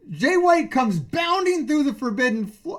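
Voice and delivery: ordinary talk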